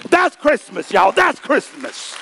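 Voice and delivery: high, loud sermon climax; shouted preaching. Real, not acted. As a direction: cry it out to the whole congregation.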